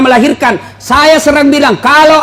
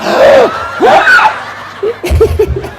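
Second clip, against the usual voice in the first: hammed-up gasp; 13 semitones higher than usual. Acting labour gasp.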